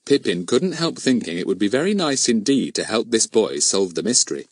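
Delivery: ordinary delivery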